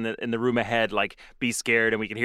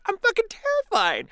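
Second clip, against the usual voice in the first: mock terrified squeal. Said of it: "I'm like".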